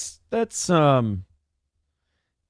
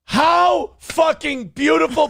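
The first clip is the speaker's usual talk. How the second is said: high, slow gleeful relish; a comic gloating at a rival's arrest; delighted; 10 semitones higher, 3 dB louder, slower than usual. Real, not acted. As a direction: savour the news with glee.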